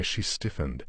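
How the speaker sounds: ordinary delivery, nothing marked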